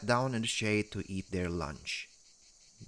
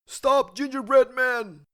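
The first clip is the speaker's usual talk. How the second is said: very high and much louder call; mower crying out; 9 dB louder than usual. A narrator's reading. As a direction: call out after him across the field. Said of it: "cried one of the mowers".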